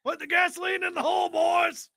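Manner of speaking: high shouted order; shouting. Pretend. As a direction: shout it to the boys. Shouting a command.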